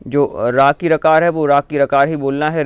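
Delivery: usual speaking voice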